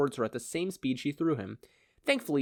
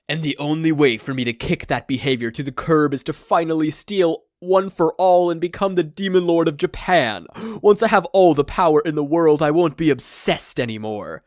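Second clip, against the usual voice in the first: louder, rising villain boast; grand villain declaration; 4 semitones higher, 8 dB louder than usual. Acted. All for One announcing his plan to rule, loud and grand. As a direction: proclaim it, growing to a shout.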